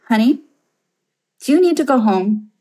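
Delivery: mildly concerned, lifted pitch; concerned mom. Retold or imitated. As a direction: imitate a mother checking in. Mother gently asking, voiced in story.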